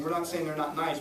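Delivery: ordinary talk, nothing marked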